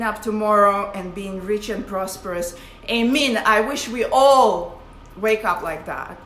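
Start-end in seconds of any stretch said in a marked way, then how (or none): none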